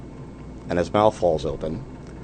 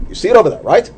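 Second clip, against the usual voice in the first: impatient professor; 12 semitones higher, 7 dB louder, faster than usual. Retold or imitated. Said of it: "next student, here".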